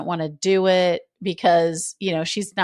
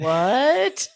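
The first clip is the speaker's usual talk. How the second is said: higher, rising mock-shocked question; retold disbelief; 5 semitones higher than usual. Co-host echoing Olivia's binder reaction, playfully incredulous. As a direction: imitate stunned incredulity.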